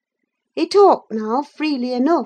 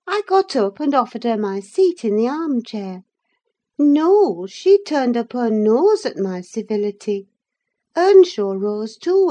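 usual speaking voice

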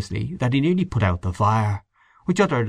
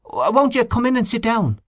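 high, pressing invitation with stammer; eager host; 13 semitones higher than usual. A narrator's reading. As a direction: urge him to sit, a bit flustered. Host urges visitor to sit.

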